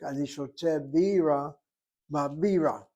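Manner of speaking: first "bira" as BI-ra, second "bira" as bi-RA; contrasting syllable stress. Shown as a demonstration. Teacher's example sentence for beer in the capital, "bira" stressed first, then last syllable. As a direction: set BI-ra against ba bi-RA. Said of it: "where the accent on the first syllable is beer".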